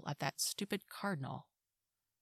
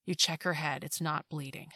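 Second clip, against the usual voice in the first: ordinary delivery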